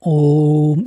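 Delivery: ओम held long and low like a chant; drawn-out om. Shown as a demonstration. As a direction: stretch ओम out over three beats. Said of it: "इस तरीके से". (ओम को लंबा खींचकर)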